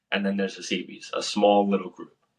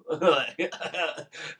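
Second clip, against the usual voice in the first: breathy whispered laugh; 7 semitones higher than usual. Real, not acted.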